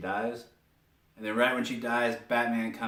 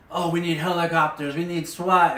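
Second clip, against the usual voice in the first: higher, louder mock panic; going crazy; 6 semitones higher, 6 dB louder than usual. Harvey after the escape, calling for backup, mock crazed. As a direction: imitate a cop frantically demanding backup. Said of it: "like, going crazy".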